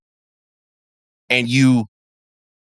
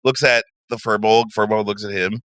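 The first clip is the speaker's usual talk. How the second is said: normal talking voice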